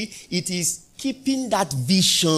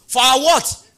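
high, loud call for the answer; loud prompt; 9 semitones higher, 11 dB louder, slower than usual. Acted. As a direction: demand the answer from the crowd. Preacher repeats prompt louder.

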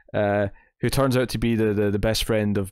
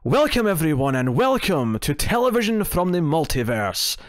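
higher-pitched, posh Scottish show introduction; posh Scottish accent; 6 semitones higher than usual. Shown as a demonstration. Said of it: "trying to do my posh Scottish accent".

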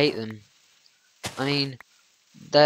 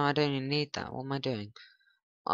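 usual speaking voice

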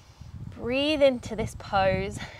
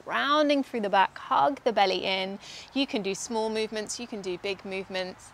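ordinary talk

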